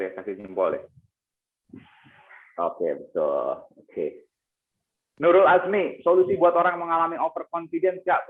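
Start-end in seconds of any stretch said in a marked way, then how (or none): 5.2-8.3 high, loud, lively read of a question. Real, not acted. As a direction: call out the viewer's question with gusto. Reading out a viewer's question aloud.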